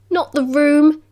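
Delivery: usual speaking voice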